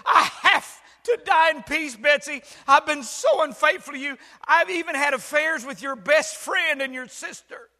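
high, loud, desperate confession; hammed-up deathbed confession. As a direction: shout the confession like a dying man. Dying husband confesses in joke.

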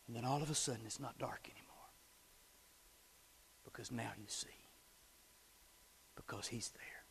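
soft whisper. Acted. Pastor whispering to the congregation after the jump. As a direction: breathe it in a hush.

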